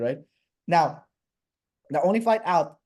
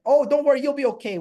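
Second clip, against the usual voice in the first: high, louder voice of a reassuring official; imitated reassurance; 10 semitones higher, 6 dB louder than usual. Airport officials to a worried traveller, high-pitched mock reassurance.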